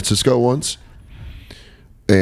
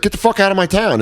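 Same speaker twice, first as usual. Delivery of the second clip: high-pitched mock angry order; bossy cop, retold; 10 semitones higher than usual. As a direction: act out a cop running someone off. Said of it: "And he's like".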